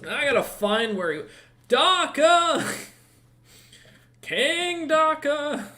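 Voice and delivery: high, drawn-out call for the king. Acted. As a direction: yell the name across the palace. Guard calling out for the king.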